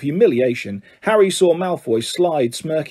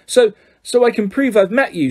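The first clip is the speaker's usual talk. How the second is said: higher, eager and quick; 7 semitones higher than usual. A narrator's reading. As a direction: push forward eagerly. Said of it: "said Colin Creevey eagerly".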